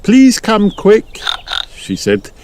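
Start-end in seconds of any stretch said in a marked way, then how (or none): 0.0-1.2 high, drawn-out call for help; high storybook mum calling out; Mummy Pig. A narrator's reading. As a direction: plead for rescue, pitched way up. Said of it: "Mummy Pig called for help".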